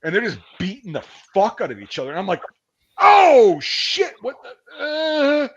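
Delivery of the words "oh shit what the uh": high startled outburst, trailing into confusion; loud, high "oh shit" of disbelief; acted-out shock. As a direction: re-enact stumbling onto something shocking. Acting out shock at fight.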